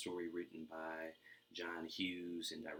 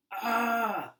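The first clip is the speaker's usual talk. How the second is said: long thrilled scream, very high and loud; shrieking whee of a kid on a toboggan; 15 dB louder than usual. Acted. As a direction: let out a wild sledding scream. Kevin sledding down stairs.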